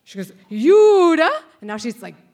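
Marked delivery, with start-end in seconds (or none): 0.5-1.3 very loud, high imitated outburst; teacher bellowing. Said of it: "bellowing at the top of her lung".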